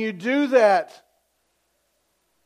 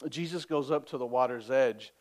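ordinary talk, nothing marked

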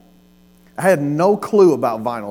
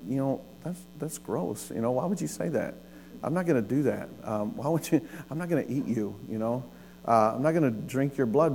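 much softer, under the breath; muttered objection; 2 semitones lower, 10 dB quieter than usual. Pretend. Man in the crowd balking at Jesus, quiet mock muttering. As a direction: mutter doubts as if to yourself.

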